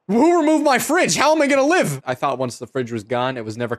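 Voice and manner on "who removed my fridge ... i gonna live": mock despair. Fridge missing, mock despair.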